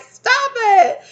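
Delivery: very high, loud shriek; playful shriek at a masked friend; shrieked stop it. Pretend. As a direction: shriek it at the prankster. Scolding a prankster.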